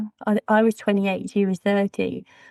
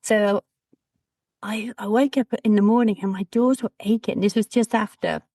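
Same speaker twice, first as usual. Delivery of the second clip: normal talking voice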